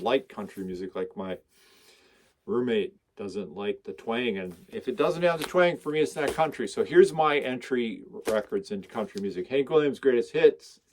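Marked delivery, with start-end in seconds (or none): none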